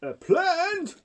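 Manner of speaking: high, loud yelp of disbelief; horrified yell. A narrator's reading. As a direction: yell it as an appalled mum. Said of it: "yelled Mum in horror".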